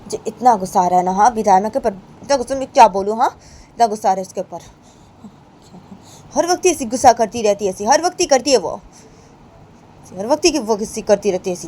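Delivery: mock angry complaining; angry girl. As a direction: vent loudly about Rama. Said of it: "बानी उन सब लोगों को बोलती है".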